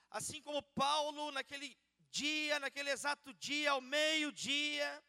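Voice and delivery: loud, high, slow prayer at full force; fervent preaching. Acted. Preacher praying to God before the church, loud fervent preaching. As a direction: pray it out to the crowd, slowly.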